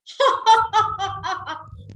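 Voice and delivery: high, free laugh pouring out; high demo laugh. Shown as a demonstration. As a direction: laugh openly to lead the group. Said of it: "Allow yourself to laugh".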